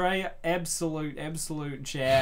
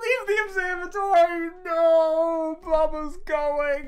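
higher, louder, hammed-up no; dramatic wail; 6 dB louder, slower than usual. Mock-grieving that Blubber leaves the observatory, hammed-up wail. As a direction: overact the heartbreak.

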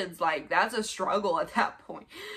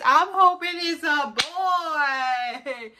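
high, loud excited squeal; 8 semitones higher, 8 dB louder than usual. Shown as a demonstration. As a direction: squeal it out, thrilled. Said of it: "But let me tell you what it is".

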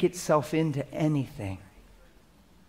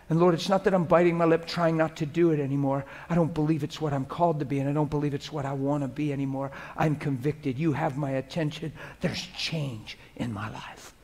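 quiet sincere prayer; 4 dB quieter than usual. Shown as a demonstration. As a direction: confess it softly before God. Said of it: "just you and him".

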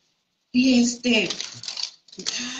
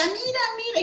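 much higher, urgent mock husband voice; excited husband; 11 semitones higher, faster than usual. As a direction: imitate the husband rushing in, high and urgent. Husband urging again. (esposo entrando corriendo, voz mucho más aguda y urgente)

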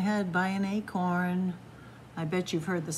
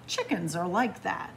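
ordinary delivery, nothing marked

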